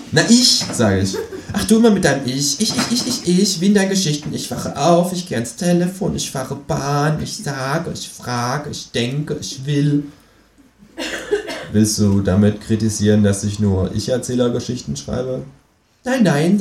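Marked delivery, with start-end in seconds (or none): 0.1-0.6 emphatic. Acted. Emphatic reply. 1.5-10.0 high, mocking Kangaroo voice; pitched-up Kangaroo, mocking every ich. Känguru mocking his ich. 16.0-16.6 high, dismissive Kangaroo voice; pitched-up Kangaroo brushing it aside. Acted. Känguru voiced.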